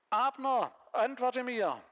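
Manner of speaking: loud, high call across the valley. Acted. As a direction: shout over to the enemy camp. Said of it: "rief zu Abner". (laut und hoch hinüberrufen)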